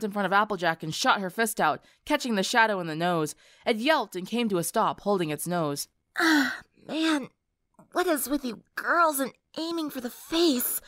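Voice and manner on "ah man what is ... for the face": pinched, nasal whine, a touch higher. Acted. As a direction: speak through the nose, nose just punched. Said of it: "Lyra asked in a nasal voice".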